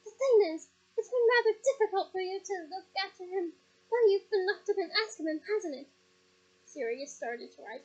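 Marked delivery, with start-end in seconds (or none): 0.0-5.9 loud, higher, cutting sarcasm; sneering. Acted. Mother sneering at Sirius about Azkaban, loud and biting. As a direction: curl the lip and sneer it out. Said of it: "her lip curling".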